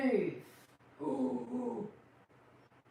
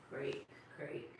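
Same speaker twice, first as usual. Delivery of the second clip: usual speaking voice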